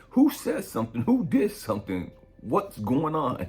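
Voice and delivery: high, loud demand for answers; acting out riled-up questioning; retold protective outrage.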